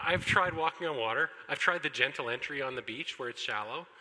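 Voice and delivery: ordinary delivery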